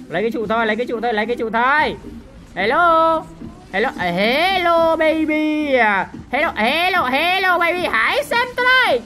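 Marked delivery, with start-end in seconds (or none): 2.5-9.0 high, stretched-out gleeful hellos; taunting greeting. Real, not acted. Player greeting enemies he is attacking, taunting call. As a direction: call out teasingly, climbing higher each time.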